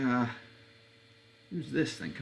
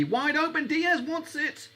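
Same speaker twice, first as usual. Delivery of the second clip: much higher, slow and drawn out; excited call; slower than usual. Real, not acted. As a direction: call it as the space opens. Chance building.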